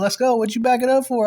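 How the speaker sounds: loud, high-pitched goading; fired-up challenge. Retold or imitated. Speaker daring the retreating man to fight, fired-up. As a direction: act out egging someone on to fight.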